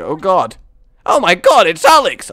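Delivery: loud, high startled outburst; startled shout. Real, not acted. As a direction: cry out in sudden surprise. Startled in game, breaks impression.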